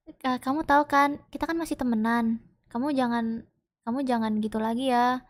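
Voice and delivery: soft, gentle comfort to a hurting friend; quiet reassurance for a friend after the scare. Retold or imitated. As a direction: speak softly, as if reassuring a hurt friend.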